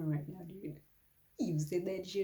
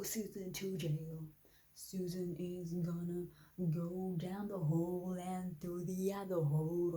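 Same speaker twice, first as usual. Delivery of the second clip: ordinary talk, nothing marked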